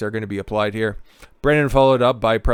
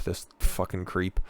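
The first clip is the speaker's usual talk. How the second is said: normal talking voice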